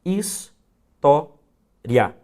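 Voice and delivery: "his-to-ria" split into three slow, separate syllables, his, to, ria. Shown as a demonstration. Teacher splitting the word for students, each syllable slow and separate. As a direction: spell out "his-to-ria" beat by beat, very slowly. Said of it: "quando eu separo a sílaba de história".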